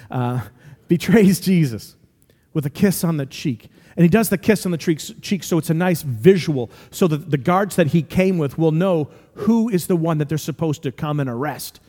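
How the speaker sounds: usual speaking voice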